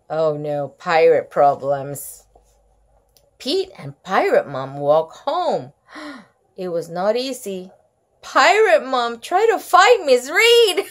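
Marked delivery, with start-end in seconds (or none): none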